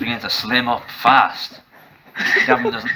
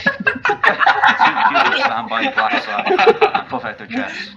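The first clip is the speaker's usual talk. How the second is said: laughing; 4 dB louder than usual. Real, not acted. Narrator laughing while reading.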